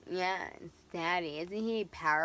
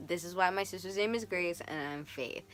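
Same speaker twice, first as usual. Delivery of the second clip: ordinary talk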